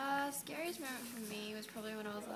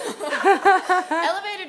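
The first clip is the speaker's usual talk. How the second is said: loud laughing buildup; 9 semitones higher, 20 dB louder, much slower than usual. Real, not acted. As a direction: laugh through the line. Laughing telling elevator story.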